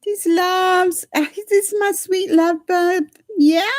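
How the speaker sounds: very high, doting coo. Real, not acted. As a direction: gush over the love bug. Cooing at her dogs.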